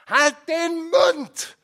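very high, loud, slow shriek. A narrator's reading. Mother shrieks at her son to shut up. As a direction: scream him silent. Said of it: "kreischt die Mutter".

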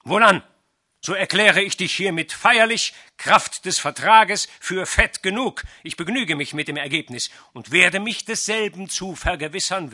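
loud, high, formal pronouncement of doom; solemn loud proclamation. A narrator's reading. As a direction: proclaim the sentence triumphantly.

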